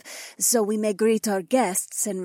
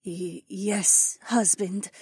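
soft, much higher, stammering wife; meek stammer; 10 semitones higher, 6 dB quieter, much slower than usual. A narrator's reading. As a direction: stammer it submissively. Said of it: "she stammered".

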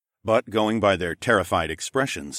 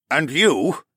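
stern questioning; 8 semitones higher, 5 dB louder than usual. Acted. Lord questions next man.

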